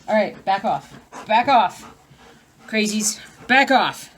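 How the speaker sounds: loud firm back off; shooing pets. Real, not acted. Owner shooing pets off her craft, loud and firm. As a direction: push the crazies away.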